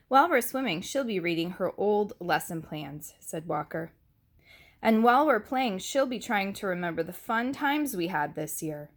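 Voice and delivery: put-on lively read-aloud for little kids; picture-book narration; story reading. A narrator's reading. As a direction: read the book brightly to the class. Reads picture book to class.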